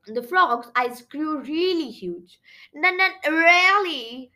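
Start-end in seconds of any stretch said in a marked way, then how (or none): 2.7-4.3 slow, higher, louder nervous stammer; frightened stammer. A narrator's reading.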